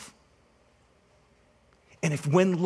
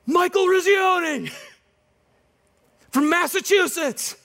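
much higher, louder, slow called-out name; retold shout; 12 semitones higher, 4 dB louder than usual. Player shouting his name at the coach, high loud call. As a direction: call it out across the rink. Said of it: "screams out".

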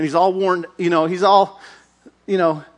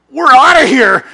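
panicked exit; 7 semitones higher, 10 dB louder than usual. Acted. Philistines fleeing.

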